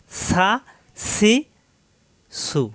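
সা, সি, সু with a sharp, unrounded s; three separate light sibilant syllables সা, সি, সু; firm thin s. Shown as a demonstration. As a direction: hiss সা, সি, সু firmly and thinly. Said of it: "শক্ত".

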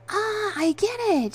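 high, loud, drawn-out "ah"; light up as the plan clicks; slow, very high delighted realization; dawning realization. A narrator's reading. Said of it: "she said, realizing my plan".

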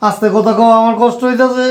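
high-pitched, whining complaint; ailing man's high wail; pained plea. Acted. Sick father begs her to quieten.

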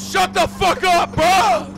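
loud, high shout; angry shout. Real, not acted. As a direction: shout him down. Yelling back at friend.

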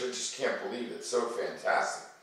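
ordinary talk, nothing marked

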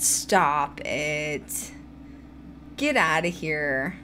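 low, slow dismissal; dismissive. Real, not acted. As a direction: brush off the critics, dropping low and slow. Brushes off critics of her accent.

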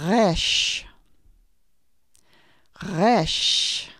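Resh with a rolled throaty r from the back of the throat; guttural Resh. Shown as a demonstration. Teacher modelling guttural Resh for learners. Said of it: "Repeat after me".

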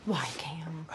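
voiceless gentle question; soft whispered why. Real, not acted. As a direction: whisper back to coax him. Whispering back in conversation.

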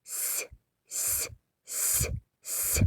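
soft thin whispered s like a tiny trickle of water; soft, thin s sound; thin water trickle hiss. Shown as a demonstration. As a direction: let it hiss out softly like a trickle. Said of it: "яка подавала ось такий звук".

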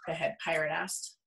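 ordinary delivery, nothing marked

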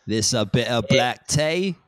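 slow, drawn-out caricature British; mock British tea teacher; British accent. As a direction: lay the British accent on thick. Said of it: "there's one in the U K".

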